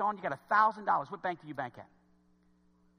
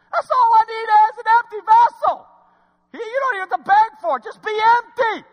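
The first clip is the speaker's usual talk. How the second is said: much higher, loud booming preacher delivery; preaching voice of God; 13 dB louder than usual. Acted. God offering power to the congregation, high ringing proclamation.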